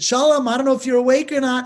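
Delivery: loud, fast call; calling through a door. Retold or imitated. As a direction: call out to wake him.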